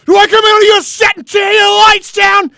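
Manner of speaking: high, full-throated yelled imitation. Actor rages at the guy on set, yelling. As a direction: yell it like a raging actor. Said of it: "he's yelling at the guy".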